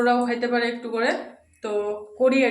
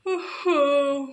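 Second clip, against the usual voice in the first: sleepy groan; 3 semitones higher, 3 dB louder than usual. Real, not acted. Just woke from a nap, drawn-out groan. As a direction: groan as you drag yourself awake.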